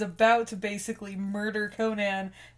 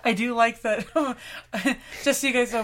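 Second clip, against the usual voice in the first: ordinary talk, nothing marked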